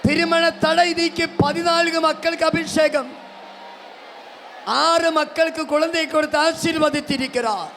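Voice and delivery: high, loud revival shouting; full-force preaching. Real, not acted. Preacher urging congregation to praise aloud, shouted at full force. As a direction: rouse the crowd with ringing, high-pitched cries.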